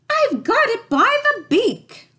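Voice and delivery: very high, loud triumphant cub; Baby Bear crying out. A narrator's reading. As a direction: shout it out with relief. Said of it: "Finally, Baby Bear cried".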